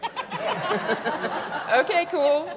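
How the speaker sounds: amused giggling reply; chuckling okay cool to the cowboy; laughing. Retold or imitated. Laughs at wife quote.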